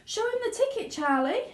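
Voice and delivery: high, eager call to Charlie; shouted eagerly. Acted. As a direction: urge him on with a high call. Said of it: "shouted Grandpa Joe".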